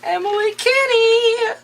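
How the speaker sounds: high, drawn-out singsong announcement; excited reveal. Real, not acted. Co-host reveals next week's villain, excited singsong. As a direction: sing out the name with relish.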